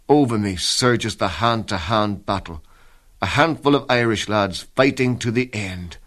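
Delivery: ordinary talk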